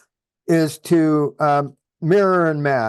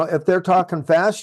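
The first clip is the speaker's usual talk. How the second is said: ordinary talk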